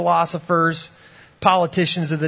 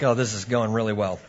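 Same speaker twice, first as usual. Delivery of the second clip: low, quick, softer dry thought; sarcastic aside; 7 semitones lower, 5 dB quieter than usual. Retold or imitated. Interviewer thinking to himself about a bad start, sarcastic. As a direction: drop it down as an ironic inner remark.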